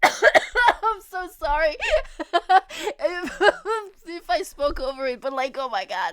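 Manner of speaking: apologising through laughter; breathless laughing apology; giggling fit. Real, not acted. Streamer laughing hard.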